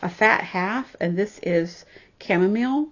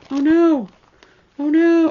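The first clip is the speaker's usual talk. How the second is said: alarmed oh no; 8 semitones higher, 8 dB louder than usual. Real, not acted. Finds a pattern seems missing.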